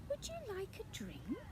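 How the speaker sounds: usual speaking voice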